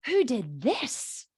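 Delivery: slow pleased question, lilting up; good tone. Acted. Proud parent asking the child about the drawing, approving tone. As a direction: stretch it out, inviting a proud confession. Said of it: "tone of the parent's voice that this is a good thing".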